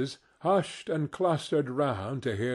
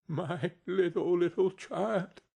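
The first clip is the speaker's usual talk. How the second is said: grieving cry; 5 semitones higher than usual. A narrator's reading. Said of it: "cried Bob".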